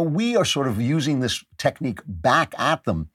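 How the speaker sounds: ordinary talk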